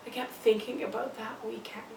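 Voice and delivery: soft, near-whispered confession; haunted hush. Acted. Mother dwells on the drinking weekend, hushed. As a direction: confide it under your breath.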